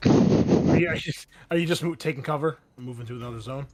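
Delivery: usual speaking voice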